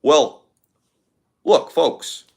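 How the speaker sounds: a light take on Obama addressing folks; Obama impression. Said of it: "Obama has a great voice".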